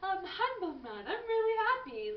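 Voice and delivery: high, loud, over-bright protest; mock cheerful denial. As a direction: insist you're happy, hammed-up. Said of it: "I was like".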